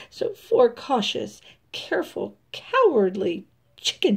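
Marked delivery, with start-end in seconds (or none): none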